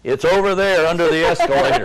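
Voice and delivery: put-on loud Boston accent with a laugh; a Bostonian answers in a Boston accent, laughing; Boston brogue. At the Louvre desk, answers in loud Boston brogue. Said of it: "in this Boston brogue".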